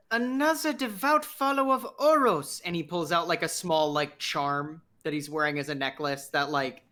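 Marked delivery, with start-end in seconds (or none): 0.1-2.6 higher, delighted exclamation in character. Acted. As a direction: greet a fellow believer with open delight. Character delighted to meet fellow believer.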